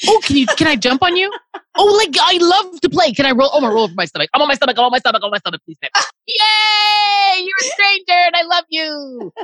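high, gushing puppy voice; overjoyed dog. Acted. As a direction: play a dog thrilled by a stranger, begging for belly rubs. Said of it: "This dog is like".

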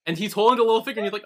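normal talking voice

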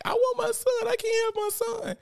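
high-pitched imitation wail; mock crying. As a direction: imitate her wailing for her son. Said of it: "She was crying like".

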